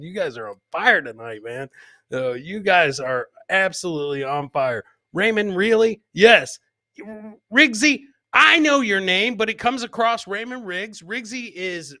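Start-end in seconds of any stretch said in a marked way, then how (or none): none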